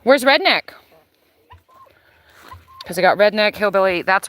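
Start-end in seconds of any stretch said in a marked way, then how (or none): 0.0-0.7 calling out. Real, not acted. Calling for the rooster.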